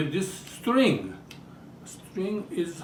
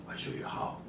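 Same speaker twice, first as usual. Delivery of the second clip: voiceless, secretive promise; whispered aside; 12 dB quieter, faster than usual. Real, not acted. About to spin the wooden eggplant, whispering to viewers. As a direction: lean in and whisper it.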